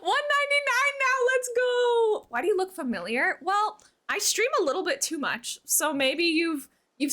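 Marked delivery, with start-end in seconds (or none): 0.0-2.3 high, loud celebration; excited cheer. Real, not acted. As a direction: cheer the milestone. Subscriber milestone cheer.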